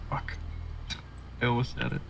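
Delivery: normal talking voice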